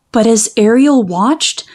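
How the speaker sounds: usual speaking voice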